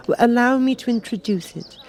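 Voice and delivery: put-on a young girl proudly introducing a friend; Alice voice. Alice introduces the Cat to the King, high and girlish.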